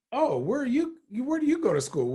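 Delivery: high, bright mock coach greeting; eager coaches.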